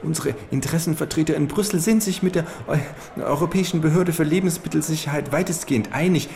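high falsetto of a small fat lobbyist. Acted. Lobbyist lecturing the kangaroo at the buffet, high falsetto. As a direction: squeak it out like a nervous little fat man. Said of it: "mit Fistelstimme".

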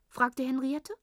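plain reading voice

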